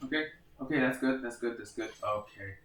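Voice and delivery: panicked quiet breathing; hyperventilating. Real, not acted. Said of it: "I'm just panicking".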